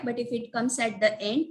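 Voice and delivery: normal talking voice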